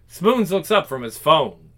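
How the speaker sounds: ordinary talk, nothing marked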